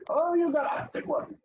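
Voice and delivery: a playful shout of surprise; oh, that's a big one; surprised. Real, not acted. Teacher reacts to long word.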